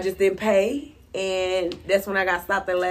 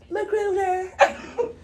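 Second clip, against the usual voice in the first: high-pitched name call with a laugh in it; high mock whine; whiny mimicry; 9 semitones higher than usual. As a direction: imitate her calling out, amused. Mimics the injured girl again.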